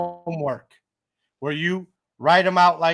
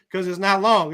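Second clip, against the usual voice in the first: ordinary talk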